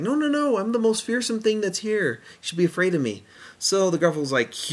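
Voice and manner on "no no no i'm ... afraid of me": boastful mouse. Retold or imitated. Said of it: "And he's like".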